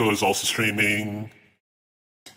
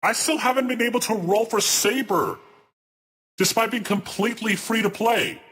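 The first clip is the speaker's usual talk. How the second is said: much higher, exasperated complaining; high-pitched rant; 10 semitones higher than usual. Real, not acted. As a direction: whine about the unfair banner. Streamer ranting about new banner.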